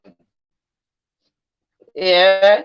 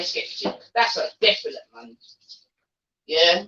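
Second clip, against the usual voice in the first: ordinary delivery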